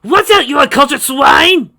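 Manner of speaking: very high and loud; loud high grumpy old man; cranky old man yell. Acted. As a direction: scold them like an angry old geezer. Old man yells at fighters.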